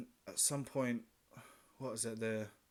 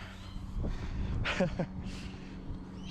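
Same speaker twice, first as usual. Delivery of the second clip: airy laugh; 8 semitones higher than usual. Real, not acted. Laughs after free wine.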